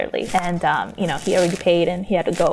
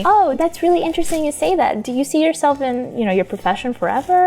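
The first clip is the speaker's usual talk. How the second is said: higher-pitched, friendly curiosity; nice and friendly; 5 semitones higher than usual. Shown as a demonstration. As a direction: ask it with friendly, easy interest. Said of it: "nice and friendly".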